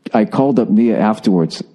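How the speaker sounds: usual speaking voice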